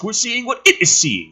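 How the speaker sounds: ordinary talk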